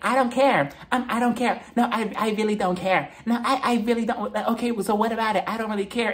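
high-pitched dismissive imitation; Portia. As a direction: mimic someone insisting they don't care. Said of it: "She was just like".

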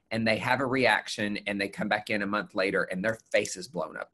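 normal talking voice

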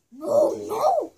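loud, high mock bear cry; growling bear. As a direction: kid hamming up a fierce bear. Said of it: "growled the bear".

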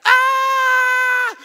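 full-throated high terrified scream; little-girl scream. Retold or imitated. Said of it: "screaming like a little girl".

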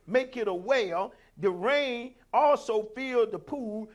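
loud, forceful scripture proclamation; full-force preaching. Real, not acted. Preacher reading the valley of Baca verse, loud preaching. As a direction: call the verse out to the congregation.

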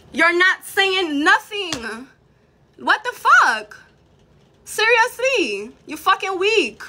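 high-pitched, slow, incredulous anger; exasperated scorn. Real, not acted. Exasperated insult during live rant.

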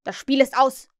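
loud despairing cry; Hook crying out. Acted. Hook tells his men all is lost, loud cry. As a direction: shout it in despair. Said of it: "rief er".

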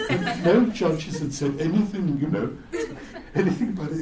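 laughing while telling; amused, laughing narration. Real, not acted. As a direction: chuckle through the words. Still laughing at the story.

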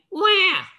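mock baby wail. Mock baby cry after bedtime book.

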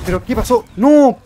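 high, loud outcry; shocked shout. Real, not acted. Player sees the attempt fail, shocked shout. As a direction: yell in disbelief, peaking on "No". Sudden failure in game.

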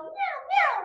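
cute high meow; high cat meow. Pretend. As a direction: meow like a little kitty. Imitating cat in song.

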